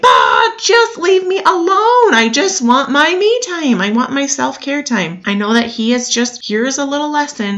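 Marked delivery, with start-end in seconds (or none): 0.0-5.2 high, pleading moan to be left alone; annoyed whine. Retold or imitated. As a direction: whine like a worn-out mom who wants her me time. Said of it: "I get so annoyed and I'm like".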